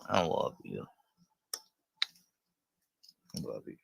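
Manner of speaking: very soft, loving and close; tender whisper to the dog. Real, not acted. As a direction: murmur love to the dog.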